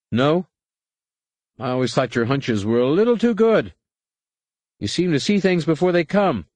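high-pitched, doubting co-pilot; sceptical co-pilot. A narrator's reading.

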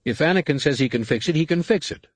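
high, quick indignant snap; snapping. A narrator's reading. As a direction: bite back in defense of Anakin. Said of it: "Valen snapped".

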